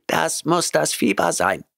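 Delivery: high cat voice, unbothered and even; calm cat. A narrator's reading. Cat Oliver calmly explaining to Grant, high cat voice. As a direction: shrug it off lightly in the cat voice. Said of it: "bemerkte Oliver gelassen".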